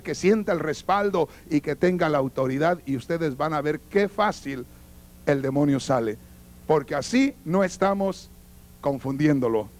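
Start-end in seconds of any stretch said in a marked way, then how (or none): none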